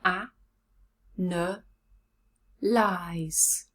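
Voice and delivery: ANALYZE broken into "A, n, lies" with long gaps; syllable by syllable. Shown as a demonstration. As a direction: count out "A, n, lies" as three separate beats. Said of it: "How many syllables?".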